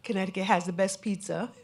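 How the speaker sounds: normal talking voice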